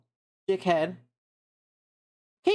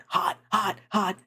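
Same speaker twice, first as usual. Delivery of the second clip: normal talking voice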